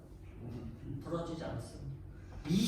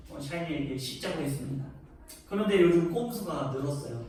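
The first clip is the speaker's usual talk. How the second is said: ordinary talk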